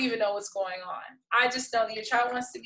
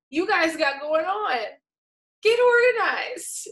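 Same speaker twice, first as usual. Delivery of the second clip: high, drawn-out mock-exasperated order; playful scolding; 7 semitones higher, 4 dB louder than usual. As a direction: scold them in fun, stretched and rising high. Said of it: "I'm trying to be playful".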